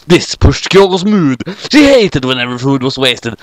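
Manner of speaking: usual speaking voice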